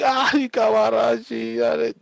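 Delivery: high, slow, loud gushing outburst; excited preacher. Retold or imitated. Preacher suddenly fired up by the first piano chord, high and loud. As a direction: burst out like a preacher set off by piano. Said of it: "el hombre dijo".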